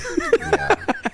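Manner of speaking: high-pitched burst of laughter; real, high giggling laugh. Real, not acted. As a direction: crack up at your own joke. Laughing at his own joke.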